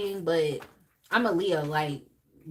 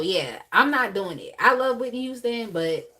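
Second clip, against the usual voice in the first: usual speaking voice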